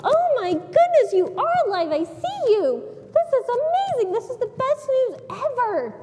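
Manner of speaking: high, excited gushing; overjoyed. Retold or imitated. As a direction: gush with amazed joy, imitating a happy friend. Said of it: "She was probably so happy".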